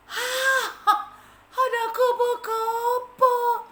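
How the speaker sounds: high, slow, loud hopeful call; excited cry for help. Acted. Ant spots the butterfly and calls out. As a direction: spot your rescuer and shout. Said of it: "katanya seperti itu".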